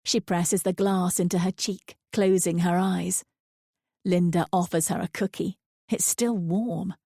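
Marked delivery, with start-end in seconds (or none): none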